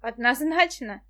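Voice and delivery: plain reading voice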